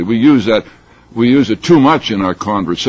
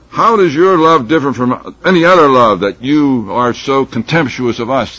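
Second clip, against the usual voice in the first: raised, higher challenge to the bride; calling out; 5 semitones higher, 6 dB louder than usual. Acted. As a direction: call out a pointed question, louder at the start. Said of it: "they call out to her".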